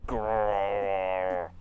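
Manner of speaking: low zombie growl. Acted. Zombie teacher rises from the ground, growling. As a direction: growl like a rising corpse. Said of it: "an inhuman growl".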